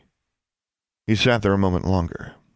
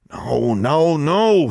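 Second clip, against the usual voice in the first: drawn groan climbing higher and louder; groaned refusal; 8 semitones higher, 4 dB louder, much slower than usual. A narrator's reading. Brother dismayed by his sister's demand, groaning. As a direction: groan it as if shaking off the thought. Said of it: "he groaned".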